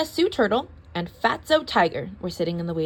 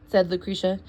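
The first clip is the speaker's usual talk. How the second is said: ordinary delivery, nothing marked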